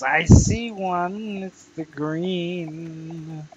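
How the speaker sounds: usual speaking voice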